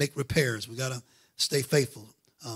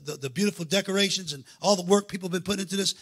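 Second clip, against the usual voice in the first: ordinary talk, nothing marked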